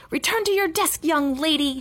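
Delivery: high-pitched, scolding teacher; narrated teacher's outrage at normal volume; furious order. A narrator's reading. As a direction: order the pupil back, pitch jumping up. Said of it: "Miss Zarves was furious".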